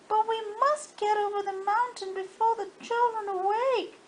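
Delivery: high, insistent plea; urgent toys. Acted. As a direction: stress the hurry before morning. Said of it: "said all the dolls and the toys".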